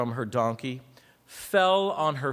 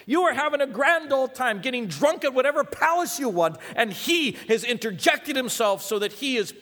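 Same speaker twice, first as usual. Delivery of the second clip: high, driving preacher delivery; impassioned pulpit proclamation; full-force preaching; 7 semitones higher, 4 dB louder than usual. Real, not acted. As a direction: preach it with rising fervor, pitch up.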